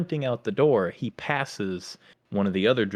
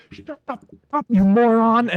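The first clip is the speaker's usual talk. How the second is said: much higher, much louder yell up the stairs; mock angry yell; 13 semitones higher, 9 dB louder than usual. Drill instructor yelling at the shrieking recruit, loud and high.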